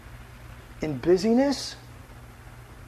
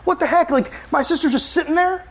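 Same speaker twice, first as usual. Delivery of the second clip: high, loud, flustered hostess voice; mock-frantic Martha fretting over the house; 10 semitones higher, 4 dB louder than usual. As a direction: panic about the perfect house. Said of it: "And she's like".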